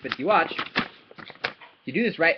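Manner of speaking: ordinary delivery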